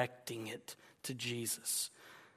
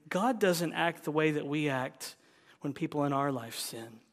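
ordinary talk, nothing marked

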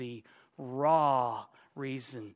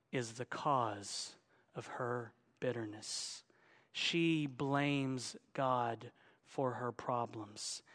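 slow, hushed, lower sermon voice; grave preaching; 3 semitones lower, 6 dB quieter, slower than usual. Real, not acted. Preacher exposing Naomi's heart to the congregation, slow and grave. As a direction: weigh each phrase softly and solemnly.

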